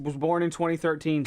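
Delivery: normal talking voice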